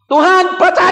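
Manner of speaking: high loud plea; imitated prayer cry. Preacher re-enacting his plea to God, high and loud. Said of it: "Saya begitu niru".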